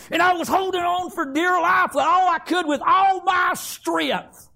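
loud, straining cry; loud desperate retelling of a nightmare struggle; desperate shout. Retold or imitated. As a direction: shout it like holding on for dear life. Reliving clinging to covers from demons.

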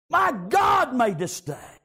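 high, loud, slow proclamation; triumphant preaching shout; shouted praise. Acted. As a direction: proclaim it with everything. Repeats it as shout.

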